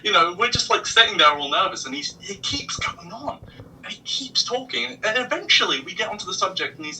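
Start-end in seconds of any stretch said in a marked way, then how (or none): 1.9-5.0 hushed whisper so the driver won't hear; whispered aside. Real, not acted. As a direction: whisper it behind the driver's back.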